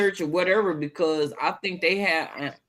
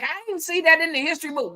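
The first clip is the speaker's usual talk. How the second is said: quick, higher-pitched mimicking; imitating clueless Southerners; 8 semitones higher, faster than usual. Speaker quoting Southerners on history, lightly mocking imitation. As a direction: lightly mimic someone claiming ignorance, pitched up and rushed. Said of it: "they like".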